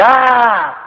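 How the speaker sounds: high, held call back; echoed holler. Acted. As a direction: answer like an echo. Echo answers.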